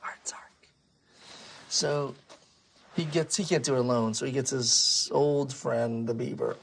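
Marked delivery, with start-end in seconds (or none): 0.0-0.7 hushed, whispered title. Acted. Storyteller repeats Art's Ark to child, whispered. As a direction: whisper it like a distant echo.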